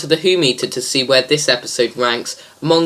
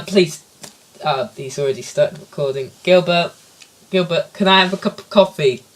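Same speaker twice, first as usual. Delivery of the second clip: usual speaking voice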